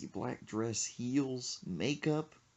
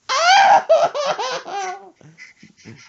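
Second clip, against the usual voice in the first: high wailing laugh; 14 dB louder than usual. Retold or imitated. Mom wailing over fish.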